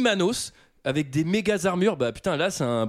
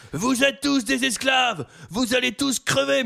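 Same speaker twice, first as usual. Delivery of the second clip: mega speech; 8 semitones higher, slower than usual. Acted. Slave trader addressing his new slaves, grandiose speech. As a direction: declaim it to a crowd, stretching the words. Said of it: "un méga speech au début".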